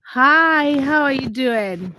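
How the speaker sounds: loud, high, slow hello; bright greeting. Real, not acted. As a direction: greet the newcomer with delight. Greeting a new chatter.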